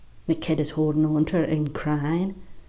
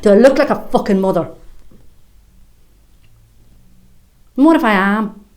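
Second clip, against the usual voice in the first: fast and louder, indignant; defensive anger; 3 semitones higher, 7 dB louder than usual. Acted. Woman denying motherhood, angry and loud. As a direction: bristle at the question.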